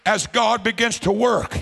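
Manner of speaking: loud, driving revival preacher's delivery; full-force preaching. Real, not acted. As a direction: preach it out hard and high to the congregation.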